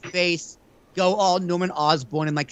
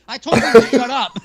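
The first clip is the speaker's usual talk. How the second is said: laughing mock yell; 7 semitones higher, 7 dB louder than usual. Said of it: "two lovers fighting over Paul Heyman".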